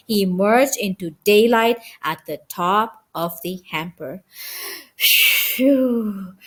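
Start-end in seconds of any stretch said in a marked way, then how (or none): 5.0-6.3 breathy phew of relief; relieved gasp. A narrator's reading. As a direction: let out a tired gasp after the climb. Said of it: "Ralph gasped".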